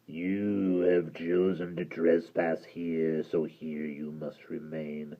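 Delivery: deep gravelly doom; menacing creature pronouncing fate; low, slow creature verdict. Acted. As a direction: sentence them slowly and low.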